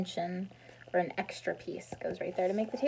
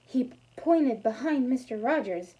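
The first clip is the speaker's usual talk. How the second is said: ordinary delivery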